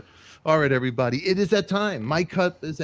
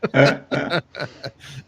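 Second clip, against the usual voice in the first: laughing; 4 dB louder than usual. Real, not acted. Laughing at own impression.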